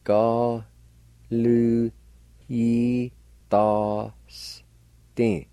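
"Go, lu, yi, da, s, tin" said as separate syllables. Shown as a demonstration. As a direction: break "Go, lu, yi, da, s, tin" into distinct beats. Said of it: "Like this".

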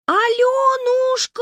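very high, slow loud call; thin-voiced call. Manya calls the girl in a thin high voice. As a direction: sing out the name in a thin high voice. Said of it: "тоненьким голосом позвала Маня".

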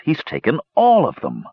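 stunned, stretching the disbelief over 'all'; gaping disbelief. A narrator's reading. Sturm reacting to the chief's news, astonished. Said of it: "Sturm gaped".

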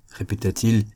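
ordinary delivery, nothing marked

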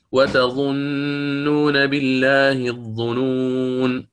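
slow chanted tajweed reading; Quran recitation. Shown as a demonstration. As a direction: draw every vowel out slowly. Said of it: "عفوًا". (تلاوة بطيئة مجودة)

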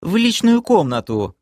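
put-on girl whining about her room decor; hurt drawl. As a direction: pout it out, a bit wounded. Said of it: "обиженно протянула девушка".

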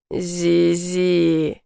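low half-voiced growl; muttered growl. A narrator's reading. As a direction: growl the name under breath. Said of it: "вполголоса прорычал герцог".

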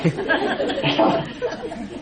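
high, breath-filled laughter. Real, not acted. Monk tickled by his own joke, high airy laugh. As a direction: let the laugh run on without words.

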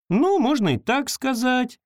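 put-on high, evasive girl's voice; girl evades creature's question. A narrator's reading. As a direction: dodge the question lightly. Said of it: "уклончиво ответила девочка".

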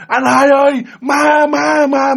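high, loud cry for help; terrified scream. Retold or imitated. Frightened younger sibling calls big brother, terrified scream. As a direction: scream out the ghost warning in panic. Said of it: "Bắt đầu mới la lên".

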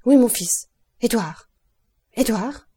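father's sudden anxious shout; alarmed cry. A narrator's reading. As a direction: burst out calling the boy's name. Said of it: "s'écria-t-il tout à coup".

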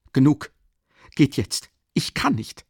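high, exasperated short bursts; agitated, irritated dismissal. A narrator's reading. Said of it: "wiederholte er gereizt".

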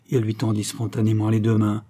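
ordinary delivery

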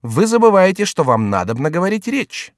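high, insistent reminder; lively high character voice; reminding jester. Acted. Chicot reminds the monk.

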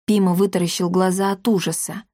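ordinary delivery

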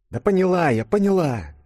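high-pitched, irritated comeback; snappish retort. A narrator's reading. Yulia to Denis on leaving the office, snappish. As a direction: snap back, pitched well up. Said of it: "огрызнулась ёжик".

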